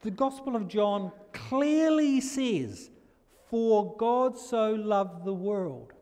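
slow, high, loud proclaiming; preacher's soaring emphasis on the Gospel; fervent preaching. Acted. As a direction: lift the verse up to the congregation. Preaching with fervour.

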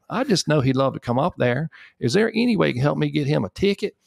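mock Southern belle on the phone; thick Southern accent. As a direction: play his Southern assistant charming a stranger on the phone. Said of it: "Grace has a bit of a thicker Southern accent".

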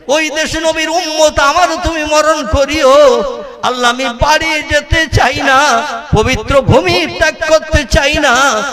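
high, ringing supplication; impassioned melodic preaching. Acted. Pilgrim begging Allah not to send him home, impassioned preaching. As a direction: chant it as a fervent plea.

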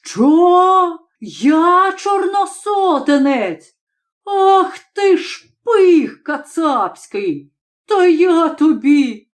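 high, slow furious roar; roaring fury. A narrator's reading. Nedotorkanyi roars at the student after being called a reactionary. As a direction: bellow it, blazing with rage. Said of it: "як спалахне, як ревне".

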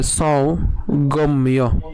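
সৌগম্য dragged out slowly, sau-gom-yo; সৌগম্য ধীরে ভেঙে; slow pronunciation demo. Shown as a demonstration. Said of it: "এর উচ্চারণ কেমন হবে".

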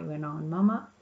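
ordinary delivery

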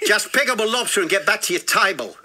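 put-on botched South African accent that sounds Aussie; accent slipping into Aussie. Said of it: "No, that's Aussie".

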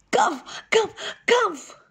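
high spoken cough cough. A narrator's reading. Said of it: "Then Armadillo went".

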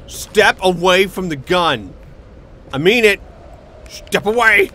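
loud, furious warning shout; angry threat. Pretend. Mock threat at game character.

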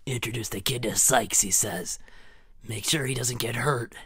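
ordinary delivery

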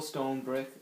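ordinary talk

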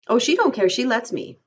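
a reader's simple Lenny, unbothered. A narrator's reading.

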